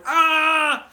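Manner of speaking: loud high startled yell; retold scream. Guy screaming in the magician's face, loud yell. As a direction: scream it right in his face. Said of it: "screaming in my face".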